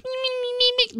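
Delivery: high squeaky chirping cricket; bad cricket impression. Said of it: "That was a very bad cricket impression".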